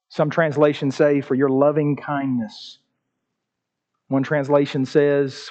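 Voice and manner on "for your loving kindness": low, soft, pleading psalm reading; lower, softer penitent reading, slowing at the end; humble scripture reading. Acted. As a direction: read it like a broken man praying. Alternate translation read.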